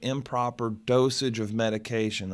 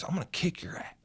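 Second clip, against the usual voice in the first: retold threat in a hushed whisper; 6 semitones higher, 9 dB quieter, faster than usual. Attorney threatens him in the hallway, imitated whispered threat.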